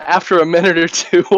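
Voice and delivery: normal talking voice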